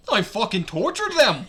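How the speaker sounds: loud, cartoon mouse boast; gleeful squeaky mascot voice; Mickey Mouse. Character brags about torture to the narrator, cartoon mouse voice. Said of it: "read that in a Mickey Mouse voice".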